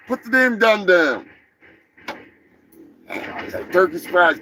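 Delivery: ordinary talk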